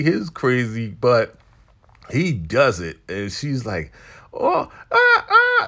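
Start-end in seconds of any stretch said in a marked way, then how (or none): 4.3-5.7 very high, loud imitated screams of pleasure; mock pleasure scream. Retelling the elder mother being pleasured, mock screaming. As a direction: squeal out exaggerated cries of pleasure. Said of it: "she's all screaming and whatever from pleasure".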